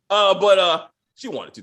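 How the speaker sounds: usual speaking voice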